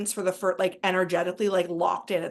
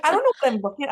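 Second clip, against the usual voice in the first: stunned excitement; 5 semitones higher, 5 dB louder, much faster than usual. Retold or imitated. Stunned by a vision in meditation.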